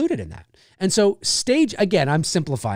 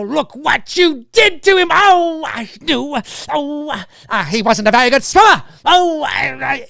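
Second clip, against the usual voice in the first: much higher, louder, theatrical wailing; Betsy Palmer impression; 8 semitones higher, 5 dB louder than usual. Imitating Betsy Palmer's theatrical scene, high and loud. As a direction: play the grieving mother to the hilt. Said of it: "her, you know".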